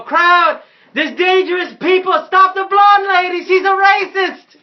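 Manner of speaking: high, very loud public alarm; drawn-out high shout, in character; yelling to a crowd. Acted. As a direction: holler a warning over a crowd. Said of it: "I yell out to the crowd".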